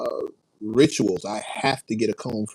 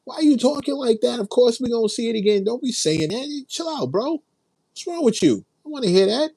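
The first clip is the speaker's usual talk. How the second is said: much higher, mock exasperated rant; retold snapping; 13 semitones higher than usual. Scolding friend Gat for doubting the Knicks, high mock outrage. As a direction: replay scolding a friend, pitched way up. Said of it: "I had to snap on Gat".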